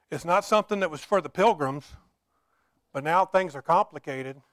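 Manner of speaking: usual speaking voice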